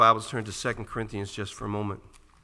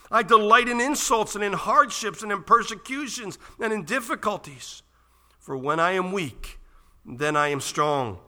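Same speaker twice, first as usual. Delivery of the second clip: loud, high, ringing pulpit voice; impassioned scripture reading; 9 semitones higher, 9 dB louder than usual. Real, not acted. Preacher reading Paul's boast in weakness to the church, impassioned proclamation. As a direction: proclaim it boldly, stretching the key phrases. Reads scripture at full force.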